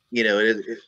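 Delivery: normal talking voice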